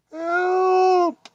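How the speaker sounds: high, loud panicked call; a kitten falling into the river screams for help; frightened cry for help. A narrator's reading. Kitten falling off the bridge, loud high panicked cry. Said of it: "cried Marie".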